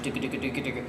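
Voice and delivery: quiet feed call chatter. Shown as a demonstration. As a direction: murmur the ticka rhythm under your breath. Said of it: "just ticka, ticka".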